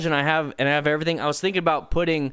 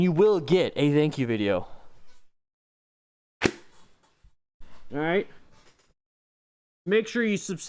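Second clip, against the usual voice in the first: ordinary talk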